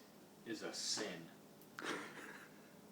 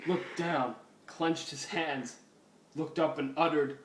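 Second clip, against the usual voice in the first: normal talking voice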